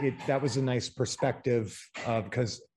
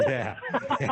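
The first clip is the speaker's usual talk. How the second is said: laughing; 2 semitones higher than usual. Real, not acted. Laughing yeah.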